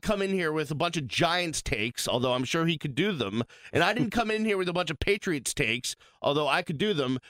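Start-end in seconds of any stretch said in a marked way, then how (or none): none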